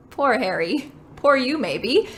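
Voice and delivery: ordinary delivery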